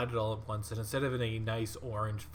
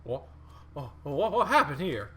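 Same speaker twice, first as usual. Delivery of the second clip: lightly voiced bewildered stranger, rising higher; puzzled, a bit higher and louder as it goes; strange voice from above; 8 semitones higher, 9 dB louder than usual. Game master voicing a confused stranger to the party, bewildered. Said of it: "you just hear this voice".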